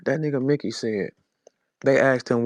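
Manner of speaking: ordinary talk, nothing marked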